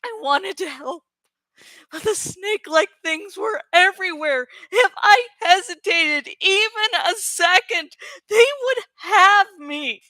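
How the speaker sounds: usual speaking voice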